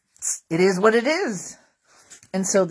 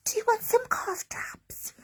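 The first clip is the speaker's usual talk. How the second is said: much higher, sweet nurse voice; 3 dB quieter than usual. Retold or imitated. Nurse offering cough drops in a high sweet voice. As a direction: pitch up to play the kind nurse.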